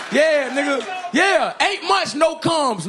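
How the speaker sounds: triumphant shout. Acted. Comedian celebrating loudly.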